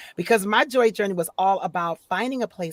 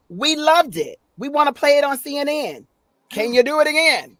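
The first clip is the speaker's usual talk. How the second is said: high, louder imitated delight; enthusiastic client; 7 semitones higher, 5 dB louder than usual. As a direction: gush with raised pitch and volume. Acts out the enthusiastic lady.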